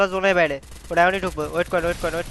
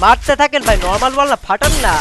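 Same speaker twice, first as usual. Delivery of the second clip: loud, higher yell to attack; urgent shout; 4 semitones higher, 9 dB louder than usual. Real, not acted. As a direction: push them to fire. Urging teammates to shoot.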